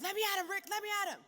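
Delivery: high, loud struggling yell; shouting to be let go. Retold or imitated. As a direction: strain against the hold, yelling. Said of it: "I was like".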